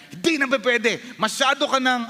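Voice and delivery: high, exasperated scolding; acted accusing rebuke; condemning voice. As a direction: scold a repeat offender. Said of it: "That's the condemnation talking".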